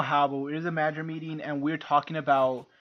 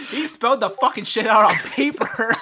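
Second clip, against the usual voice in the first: loud, high, on the edge of laughter; real incredulous hilarity, voice raised; laughing disbelief; 5 semitones higher, 6 dB louder than usual. Real, not acted. Telling co-hosts of the boss's blunder, cracking up.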